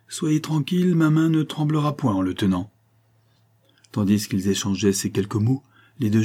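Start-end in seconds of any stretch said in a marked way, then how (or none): 0.1-2.7 high-pitched, sustained vow; roaring resolve. A narrator's reading. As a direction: swear it high and intense, without shouting. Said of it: "laissa échapper comme un rugissement". (comme un rugissement contenu)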